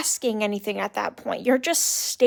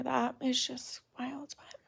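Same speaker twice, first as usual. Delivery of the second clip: whispered aside; 13 dB quieter than usual. Real, not acted. Unvoiced aside.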